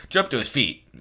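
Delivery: ordinary delivery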